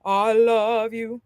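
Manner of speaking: very slow, open yawning throat; drawn out on a yawn; slow yawned demo; yawned speech. Shown as a demonstration. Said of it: "I want you to yawn".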